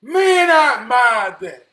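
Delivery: slow, high, booming admiration; real heartfelt outburst at full volume; impassioned shout. Real, not acted. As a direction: shout your admiration, stretching each word.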